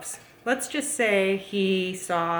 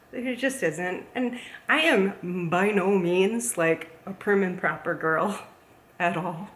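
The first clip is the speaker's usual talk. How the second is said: usual speaking voice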